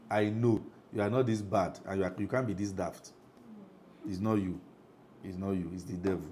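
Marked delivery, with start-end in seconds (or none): none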